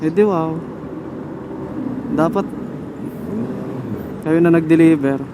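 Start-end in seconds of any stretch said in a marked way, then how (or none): none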